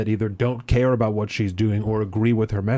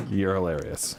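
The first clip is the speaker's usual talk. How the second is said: usual speaking voice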